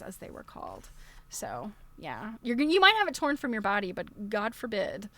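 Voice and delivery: usual speaking voice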